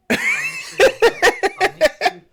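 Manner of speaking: very high and loud squeal through laughter; laughing squeal. Retold or imitated. Laughing mock exaggerated voice.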